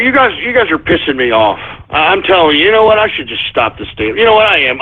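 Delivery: ordinary talk, nothing marked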